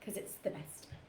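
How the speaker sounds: hushed, breathy whisper of mock praise; whispered tongue-in-cheek brag; whispered aside. Real, not acted. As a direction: murmur it as a secret joke. Mock praise of own book.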